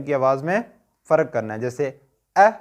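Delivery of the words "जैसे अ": light thin अ from the throat; light hamza a. Shown as a demonstration. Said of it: "हमज़ा को बारीक अ".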